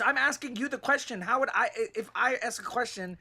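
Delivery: exasperated. Real, not acted. Frustrated arguing over the game.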